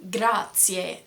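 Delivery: grazie with a clear ts, "grah-tsyeh"; TS sound. Shown as a demonstration. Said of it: "Z sound is actually a T S".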